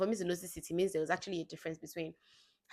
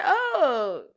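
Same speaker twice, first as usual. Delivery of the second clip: mock dismay; 9 semitones higher, 8 dB louder than usual. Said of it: "I'm just like".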